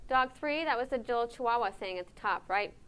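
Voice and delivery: usual speaking voice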